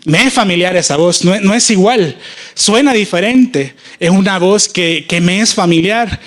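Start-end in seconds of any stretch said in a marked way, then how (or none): none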